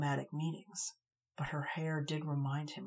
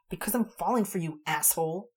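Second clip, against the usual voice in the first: loud and higher, hurt; angry confession; 3 semitones higher, 7 dB louder than usual. A narrator's reading. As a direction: blurt out the feeling in anger.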